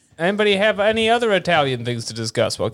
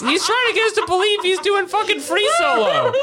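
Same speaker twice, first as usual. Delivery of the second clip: laughing shout. Real, not acted. Laughing rant about Stallone.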